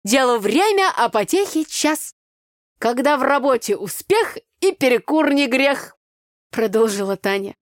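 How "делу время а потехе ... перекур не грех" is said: put-on loud, high folksy village girl; brassy country girl scolding; Tanya's brash voice. Village girl Tanya, loud and brash.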